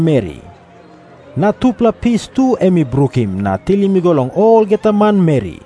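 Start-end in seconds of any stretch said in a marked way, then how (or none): none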